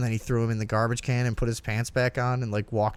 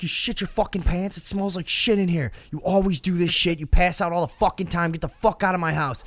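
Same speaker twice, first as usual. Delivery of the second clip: higher-pitched mock rant, fake disgust; mock angry yelling; 5 semitones higher than usual. Friend faking fury at the drunk guy for shitting himself, higher mock rant. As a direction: play up the outrage, pretending he's filthy.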